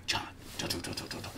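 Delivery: hushed, intimate whisper of his name; breathy whisper. Retold or imitated. Girl whispering his name to him, hushed. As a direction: whisper it like a lover leaning in.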